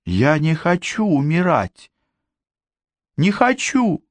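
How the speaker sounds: put-on high, breaking, pleading girl. A narrator's reading. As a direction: beg for her life as the voice breaks. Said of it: "Её нежный голосок сорвался".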